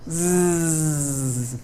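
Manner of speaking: a soft, sustained voiced buzz; buzzing zzz. Shown as a demonstration. Teacher shows the buzzing z sound. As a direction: hum a long zzz sound.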